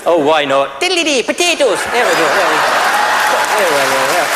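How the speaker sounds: stage-Irish lilt, jaunty; Irish accent. Pretend. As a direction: ham up a cheerful Irish fella. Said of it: "maybe the Irish turn up".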